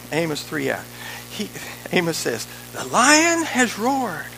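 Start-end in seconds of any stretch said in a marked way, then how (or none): 2.7-4.2 slow, higher proclamation; declaimed scripture. Acted. Preacher quotes Amos to the church, declaiming. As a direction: ring out the verse, drawn out slowly.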